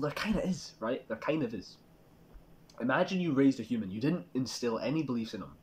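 ordinary delivery

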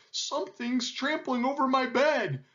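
high-pitched howled complaint; howling lion. A narrator's reading. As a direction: wail it like a trampled lion. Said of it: "howled the lion".

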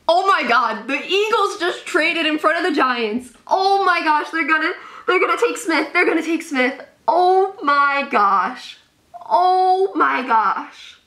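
high-pitched, rushed panic stretching into long exclamations; alarmed shock. Real, not acted. Giants fan seeing the Eagles trade ahead, alarmed. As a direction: cry out in real dismay.

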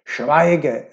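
loud, menacing order; threatening command. A narrator's reading. Drunk husband threatens his wife, loud and menacing. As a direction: silence her with a threat.

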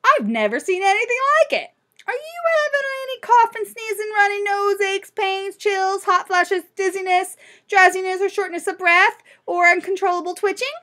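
high-pitched, excitable storybook doctor; fussy list of questions, held high; astonished doctor. Acted. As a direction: rattle off symptoms in a high, sustained voice.

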